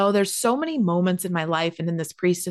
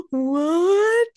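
high tearful gasp, then a drawn-out cry; mock a friend bursting into tears of shock; hammed-up crying disbelief; imitated bawling; 11 semitones higher, 3 dB louder than usual. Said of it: "She starts bawling, crying".

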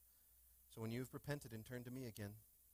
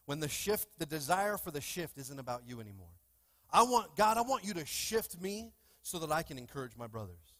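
loud, rising preacher's cry; full-force preaching; 3 semitones higher, 11 dB louder than usual. Acted. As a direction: build the prayer to a shout.

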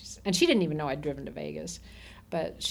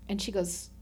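ordinary delivery

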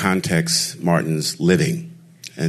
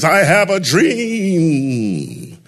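very high, very slow, drawn-out declaiming; shouting the speech; 12 semitones higher, much slower than usual. Retold or imitated. Boy on his bed shouts King's speech, drawn-out and high. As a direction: boom it out like a boy playing the preacher. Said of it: "shouting at the top of my lungs".

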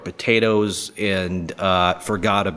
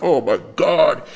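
stunned; 6 semitones higher, 4 dB louder than usual. Retold or imitated. Speaker's stunned reaction retold.

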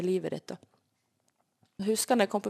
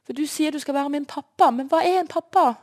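loud, high pleading shout; begging God to show what a father is, loud; desperate cry; 7 semitones higher, 7 dB louder than usual. Retold or imitated. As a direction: plead at the top of your voice. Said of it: "Jeg er desperat".

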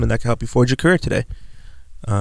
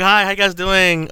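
higher, slower polite office greeting; high corporate voice; 8 semitones higher than usual. Shown as a demonstration. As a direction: sound extra friendly to coworkers. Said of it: "my voice gets dumb high".

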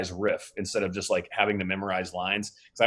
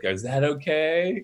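slow, higher, mild-mannered question; chill checking in; 5 semitones higher, slower than usual. Retold or imitated. Director imitating actor after his screaming, chill questioning. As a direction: ask slowly, easygoing, rising at the end. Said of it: "he's so, like, chill".

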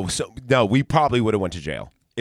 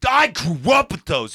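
slow, punched-out accusation; 3 semitones higher, slower than usual. Retold or imitated. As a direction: lean on each word, seething and deliberate.

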